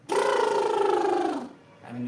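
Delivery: loud rasping breath through the cords; harsh vocal fry burst. Shown as a demonstration. Streamer demos a funny-sounding growl exercise. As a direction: rasp a loud unvoiced growl. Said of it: "which kind of sounds funny".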